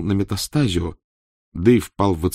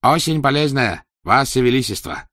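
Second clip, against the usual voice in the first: eager reassurance; 6 semitones higher, 4 dB louder than usual. Acted. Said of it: "немедленно заверил грек".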